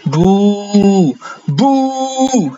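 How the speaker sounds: high mock boo; hammed-up boo at the numbers; playful booing. Booing the low payout.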